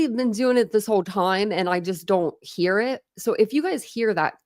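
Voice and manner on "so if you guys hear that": usual speaking voice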